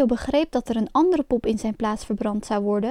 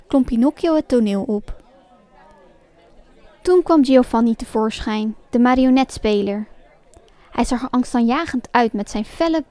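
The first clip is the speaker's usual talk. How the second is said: plain reading voice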